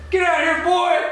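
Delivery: high, loud mock threat; gruff attacker. Stranger threatening the roommate, high loud mock threat. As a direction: bark it like a menacing stranger. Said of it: "all of a sudden I hear".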